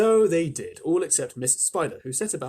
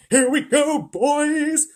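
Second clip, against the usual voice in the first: much higher, louder gleeful cheering; excited shouts; 12 semitones higher, 9 dB louder, slower than usual. A narrator's reading. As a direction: shout it like an eager crowd. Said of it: "mounting excitement and shouts of".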